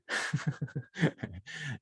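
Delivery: amused little laugh at oneself; light laugh. Real, not acted. Host correcting the brother-and-sister detail, light laugh.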